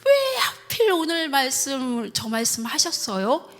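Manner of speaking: complaining prayer, rising into protest; plaintive grumbling. Retold or imitated. Wife asking God why today's sermon, plaintive grumbling. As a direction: whine upward at the whys, acting it out. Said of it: "제가 푸념처럼".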